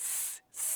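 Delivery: "স" as a drawn-out unvoiced sibilant; isolated S sound. Shown as a demonstration. Teacher showing how C sounds, "স" as a sustained s. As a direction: hold "স" as a pure s sound. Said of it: "এরকম একটা উচ্চারণ হবে".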